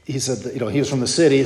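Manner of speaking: ordinary talk, nothing marked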